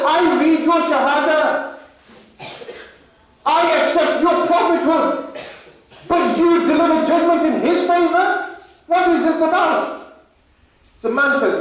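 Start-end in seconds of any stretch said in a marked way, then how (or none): 0.1-9.9 indignant protest. Acted. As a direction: protest the ruling at full heat. Angry man protests the ruling.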